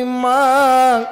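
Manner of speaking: calling out. A narrator's reading. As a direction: call for mother on a long held note. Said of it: "ডাক দিয়ে কই".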